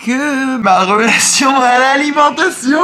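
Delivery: mock dramatic outburst. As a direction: belt it out in comic exaggeration. Comic outburst about food.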